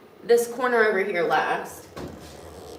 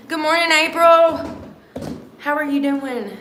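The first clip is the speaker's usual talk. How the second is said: bright, loud, higher good morning; cheery greeting; 6 semitones higher, 6 dB louder than usual. Real, not acted. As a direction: sing out a morning hello.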